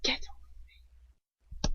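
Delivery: slow, soft whispered threat; cold whisper. Acted. Lucius whispers coldly to the captive at his feet. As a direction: breathe the order out with contempt.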